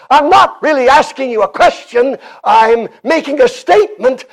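ringing, insistent preacher; emphatic preaching. Acted. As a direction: make a statement of it, with full pulpit conviction. Preaches at full force.

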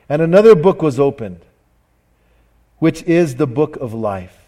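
slow, weighty Bible reading; solemn scripture reading. Acted. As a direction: read each phrase like a pronouncement. Reads Revelation aloud.

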